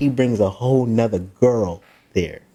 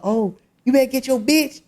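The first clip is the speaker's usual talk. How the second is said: high-pitched retold warning; mock confrontation; 12 semitones higher than usual. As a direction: imitate a man squaring up. Acted quarrel in retold story.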